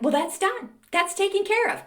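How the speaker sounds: high, airy retold dismissal; breezy mom. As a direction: imitate mom lightly brushing it off. Mother suddenly breezy after threat.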